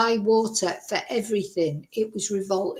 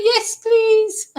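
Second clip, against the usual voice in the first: much higher and louder, squealing with eagerness; desperate yes please; 12 semitones higher, 6 dB louder than usual. Retold or imitated. Parched guest grabs at neighbour's offered tea, squealing eagerly. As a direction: leap at the offer like you're dying of thirst.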